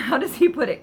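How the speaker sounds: ordinary delivery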